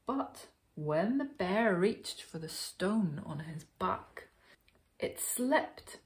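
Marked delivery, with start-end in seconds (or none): none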